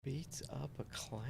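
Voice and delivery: breathy whisper to himself. Real, not acted. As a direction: whisper it under your breath. Muttering the clue to himself.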